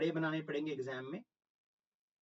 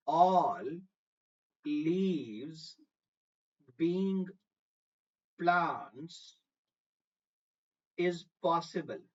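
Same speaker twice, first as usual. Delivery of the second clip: slow, spaced out with a pause after each word; careful word-by-word; much slower than usual. Shown as a demonstration. Teacher has students listen closely to the wording, careful word-by-word. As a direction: deliver it one weighty word at a time. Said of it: "language सुनना अब थोड़ा संभल के".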